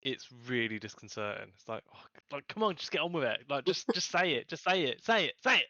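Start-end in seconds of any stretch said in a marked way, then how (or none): 1.9-5.6 high-pitched, exasperated hurry-up, building with each repeat; mock impatience. Acts out impatience at normal-speed podcasts.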